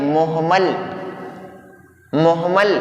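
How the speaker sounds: मुहमल with short u and an audible h, muh-mal; correct pronunciation. Shown as a demonstration. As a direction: model मुहमल clearly for the class. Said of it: "इसका सही तलफ़ुज़ क्या है".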